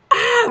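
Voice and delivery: high, loud fangirl squeal; excited scream. Retold or imitated. Telling how the girls freaked out at the performers, mock excited scream. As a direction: freak out with a short shriek. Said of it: "We, like, freaked out".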